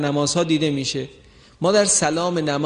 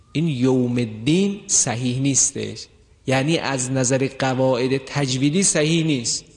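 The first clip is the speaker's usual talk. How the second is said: normal talking voice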